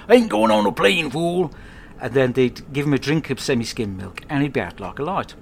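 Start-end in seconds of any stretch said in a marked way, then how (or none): 0.1-1.5 high, loud growling tough-guy bark; loud mock tough-guy refusal; B A impression. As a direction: bark it like B A from the A-Team. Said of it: "he'd say something like".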